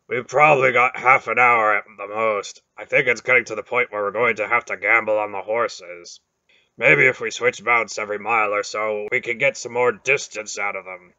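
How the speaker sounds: a burly lord weighing the gamble; big warrior voice. A narrator's reading. Beric urging the others to gamble on the horses, heavy and loud.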